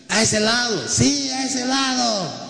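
high, drawn-out shout to the boat; the Lord calling from the shore to the boat; calling across water. Retold or imitated. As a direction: stretch the call so it carries over the lake. Voice from shore directing fishermen.

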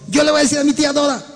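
voz más aguda y rápida del joven; imitando a Alex. Retold or imitated. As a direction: imita al joven entusiasta, aprisa. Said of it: "Él dijo". (imitando al joven Alex con voz aguda y rápida)

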